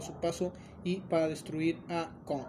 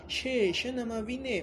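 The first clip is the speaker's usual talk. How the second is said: put-on slow, higher Argentine accent; mock Argentine accent; 6 semitones higher, slower than usual. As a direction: play the reluctant Argentine friend. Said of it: "no tiene apellido argentino". (acento argentino exagerado, más agudo y lento)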